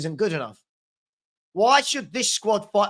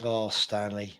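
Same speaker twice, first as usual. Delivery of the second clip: ordinary talk, nothing marked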